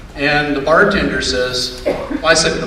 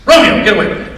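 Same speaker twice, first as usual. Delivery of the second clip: mildly raised voice, higher; acted scolding; 5 semitones higher, 4 dB louder, much faster than usual. Owner calling his dog off gasoline, acted scolding. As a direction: call the dog off sharply.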